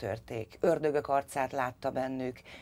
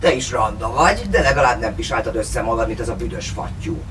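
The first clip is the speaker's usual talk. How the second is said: put-on drunk father; 8 dB louder than usual. A narrator's reading.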